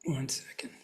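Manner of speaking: quick whispered aside to himself. Real, not acted. Speaker to himself, losing his notes, whispering. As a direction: drop to a whisper while finding his place.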